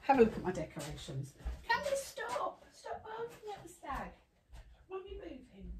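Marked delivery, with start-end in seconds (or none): 1.7-5.7 high, much softer pretend-dog voice; voicing the dog. Owner speaking as her dog asking Mummy for help, high and soft. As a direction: speak for the dog like a small child.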